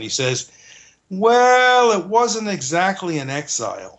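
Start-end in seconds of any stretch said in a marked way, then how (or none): 1.1-4.0 quoted reply in a put-on Israeli accent. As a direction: lightly mimic an Israeli professor's hedging answer. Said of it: "in his charming Israeli accent".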